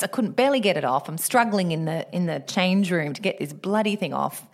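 ordinary delivery

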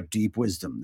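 low, quiet mock guru; mock sage. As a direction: murmur it like a solemn oracle. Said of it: "and I go".

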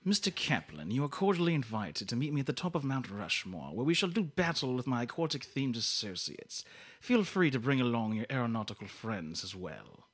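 put-on stately villain invitation in a James Mason accent. Villain invites Mister Kaplan to battle, James Mason accent. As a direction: issue a formal challenge in a James Mason accent. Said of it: "Can I just get the accent again?".

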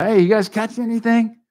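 calls out loud and high across the water; yelling out. Retold or imitated. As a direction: shout it to the boat from shore. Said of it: "Jesus yells out".

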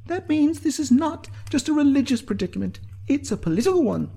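high-pitched, assertive briefing; Sinead's character voice. A narrator's reading.

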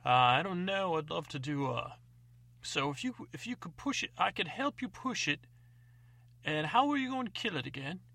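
put-on a greedy old codger eager for the carcass; old man. Old man covets the cheese.

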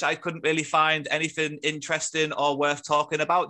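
ordinary talk, nothing marked

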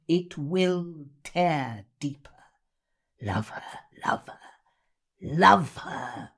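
slow, intense reading with long pauses; Miss Havisham. Acted. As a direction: read it as a bitter, obsessed jilted bride. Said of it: "Miss Havisham is Dickens".

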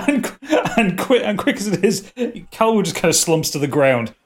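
ordinary delivery

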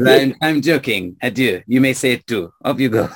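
put-on French-accented reassurance; joking French stranger. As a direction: send them off with a French accent. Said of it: "unless you're with a French person".

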